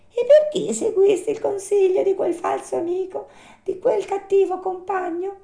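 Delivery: very high little-animal voice; reproachful marmot. Acted. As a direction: question reproachfully in a tiny, high voice.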